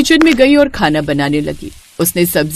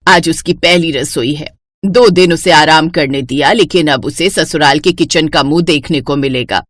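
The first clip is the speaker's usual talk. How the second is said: low, bossy mother-in-law voice; a domineering saas giving orders; lower-pitched strict older woman; stern mother-in-law; 7 semitones lower than usual. Acted.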